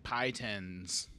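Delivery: normal talking voice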